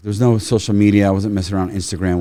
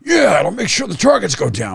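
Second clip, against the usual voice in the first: high, eager gun-guy voice; hyped ammo guy; 11 semitones higher, 3 dB louder than usual. Retold or imitated. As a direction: play the guy who swears by hot loads.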